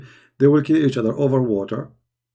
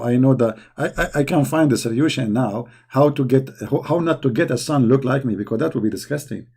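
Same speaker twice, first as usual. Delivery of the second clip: normal talking voice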